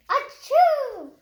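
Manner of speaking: high, loud hammed-up achoo; big sneeze. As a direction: blast out a cartoon sneeze. Gerald sneezes again.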